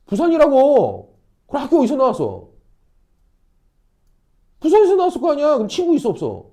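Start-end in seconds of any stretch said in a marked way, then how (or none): 0.1-1.1 drawn out, higher and louder; slow emphatic answer. Shown as a demonstration. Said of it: "emphatic slow answer". 4.6-5.5 much higher and louder; emphatic retort. Real, not acted. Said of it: "emphatic rhetorical point".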